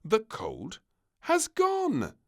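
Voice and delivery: rising high, loud announcement; triumphant reveal; announcing. Acted. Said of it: "the doctor announced finally".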